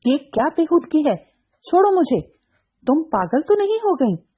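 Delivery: high, panicked protest; flustered. A narrator's reading. Saif trying to shake her off, high flustered protest. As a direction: push her off in alarm. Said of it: "बुरी तरह बौखलाकर".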